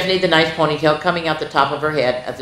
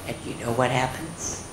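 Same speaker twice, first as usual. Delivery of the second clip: ordinary delivery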